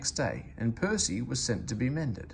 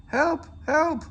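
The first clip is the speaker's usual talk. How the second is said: high, loud frightened cry; panicked whistle; 5 dB louder than usual. Acted. Percy whistles for help while runaway, high panicked cry. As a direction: shriek for help as you slide. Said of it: "whistled Percy".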